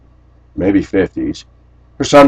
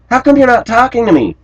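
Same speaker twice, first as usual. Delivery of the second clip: much higher pouty question; mock whiny coworker; 13 semitones higher than usual. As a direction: imitate her plaintive ask. Coworker quoted.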